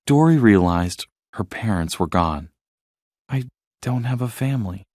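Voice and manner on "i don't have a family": much softer, heavy with loss; understated quiet grief; sad. A narrator's reading. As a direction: say it quietly, heartbroken. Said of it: "she said sadly".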